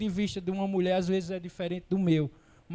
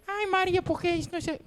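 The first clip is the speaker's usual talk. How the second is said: much higher, thin put-on woman's voice; man doing a woman's voice; 11 semitones higher, faster than usual. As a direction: squeeze the voice up high like a caricatured woman. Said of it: "tem que afinar a voz?". (homem fazendo voz de mulher, bem fina)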